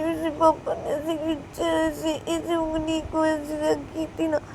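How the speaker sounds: put-on high, slow, hurt little girl; child explaining and begging mummy; small girl voice. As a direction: talk like a small hurt daughter. Child voice held.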